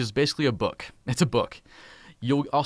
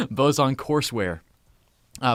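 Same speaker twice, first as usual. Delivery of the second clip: ordinary talk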